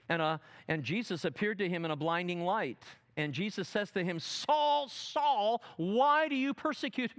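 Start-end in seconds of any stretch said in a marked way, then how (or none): 4.2-7.2 high, raised call. Acted. Jesus to Saul on the road, high call. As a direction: demand his attention.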